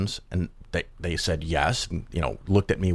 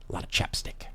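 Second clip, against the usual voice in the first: breathy whispered afterthought, much softer; whispered aside; 9 dB quieter than usual. Acted. Telling viewers about the chapstick, in a mock-secret whisper. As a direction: drop to a whisper for the punchline.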